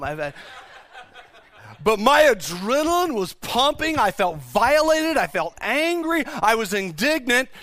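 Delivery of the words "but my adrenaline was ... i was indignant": impassioned indignation. Real, not acted. Preacher reliving adrenaline at the break-in, impassioned and indignant. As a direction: preach it at heated pitch, stretching key words. Reliving his anger at the intruder.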